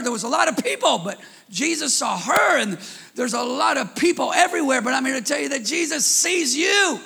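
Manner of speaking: high, loud, climbing preacher's shout; full-force preaching. Acted. Pastor to his congregation, shouting at full force.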